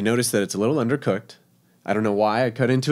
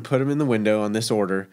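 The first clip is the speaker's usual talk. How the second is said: usual speaking voice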